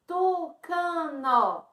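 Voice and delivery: TUCANO stretched very slowly into TU-CA-NO; slow syllable split. Shown as a demonstration. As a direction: draw out all three syllables of TUCANO. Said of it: "Então, tem três sílabas".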